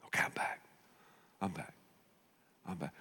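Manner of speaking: hushed soothing whisper. Acted. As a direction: whisper it back to sleep. Soothing God back asleep.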